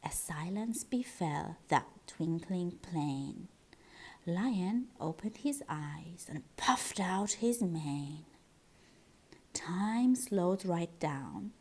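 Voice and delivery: hushed suspense. A narrator's reading. The narrator builds suspense before the lion answers. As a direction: draw the listeners in with a hush.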